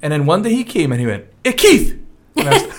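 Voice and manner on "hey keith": high bright call of Hey, Keith; calling out cheerfully. Retold or imitated. Landlord calling out to her by the wrong name. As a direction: hail him across the yard.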